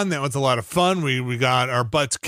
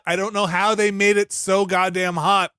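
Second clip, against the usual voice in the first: ordinary talk, nothing marked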